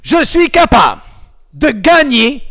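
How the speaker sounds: loud, high rallying cry; shouted affirmation. Shown as a demonstration. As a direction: shout it out like a karate coach. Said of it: "coach shouts affirmation".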